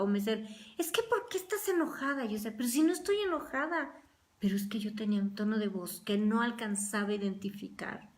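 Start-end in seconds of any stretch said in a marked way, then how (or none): 0.8-2.2 imitated questioner. People asking why she's angry. 2.5-3.9 defensive protest. Retold or imitated. Her own defensive answer retold.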